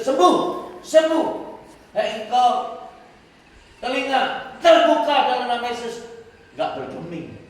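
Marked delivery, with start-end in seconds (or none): 0.0-6.0 commanding healing shout. Retold or imitated. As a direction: call out the healing like a command to the ears.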